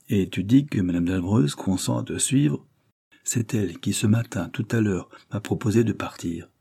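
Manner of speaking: usual speaking voice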